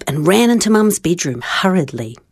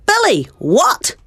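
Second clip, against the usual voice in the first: higher, slow insistent call; mild mock shout; 8 semitones higher, slower than usual. Child demanding brother's attention, slow mild shout. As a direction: drag it out to make him answer. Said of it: "I shouted".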